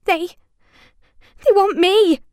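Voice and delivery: high, halting fear; falter, then blurt it out; frightened. A narrator's reading. Izzy terrified of dolls.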